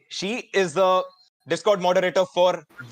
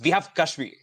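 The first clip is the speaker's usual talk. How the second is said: ordinary talk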